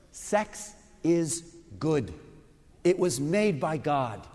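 slow, deliberate statement; slow emphatic declaration to the congregation; said clearly. Shown as a demonstration. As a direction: lay down each word slowly. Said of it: "let me say this clearly and up front".

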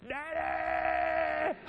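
a retold yell for Daddy, not full force; cupped hands, calling out for Daddy; retold shout. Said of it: "I screamed as loud as I could".

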